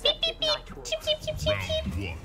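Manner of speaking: high, soft chick peeps; peeping baby chick. Retold or imitated. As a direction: chirp like a tiny bird. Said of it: "it's got a little bird, like".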